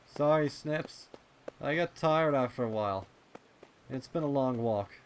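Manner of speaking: put-on a tired young pony catching his breath, apologising; young colt voice, weary; Snails, tired and apologetic.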